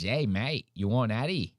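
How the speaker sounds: soft, hammed-up Australian mate; mock Australian accent. Streamer mocking the offer to a mate, Australian accent. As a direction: put on a casual Australian accent. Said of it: "The fucking Australian accent".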